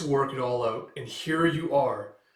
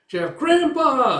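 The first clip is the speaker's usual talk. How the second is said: high, loud, drawn-out happy call, slow; excited child call; 12 semitones higher, 10 dB louder, much slower than usual. Acted. Granddaughter running to grandpa, excited high slow call. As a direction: squeal for grandpa.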